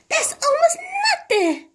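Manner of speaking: very high, half-laughing despair; fretful old lady crying out; high wailing lament. A narrator's reading. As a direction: throw up your hands at it. Mrs Silver laments the weight.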